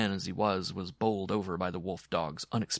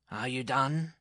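higher, controlled questioning voice; unmoved dwarf; 6 semitones higher, slower than usual. A narrator's reading. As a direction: ask coolly as the little man.

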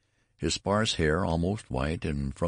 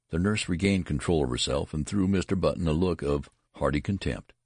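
ordinary delivery

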